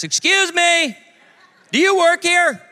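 high, loud mimicked customer; calling out. Customer mistaking him for retail staff, calling out. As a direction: hail a store clerk from across the aisle.

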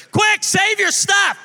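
urgent high-pitched shout; yelling. Retold or imitated. Dad warning the children mom is decluttering, yelling. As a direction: holler a warning through the house. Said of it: "yelling to the children".

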